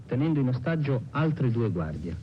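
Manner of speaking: usual speaking voice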